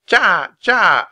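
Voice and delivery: loud, high calls from outside; calling out. Acted. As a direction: Annie calling Jack from the entrance. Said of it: "Annie was calling to him from the outside".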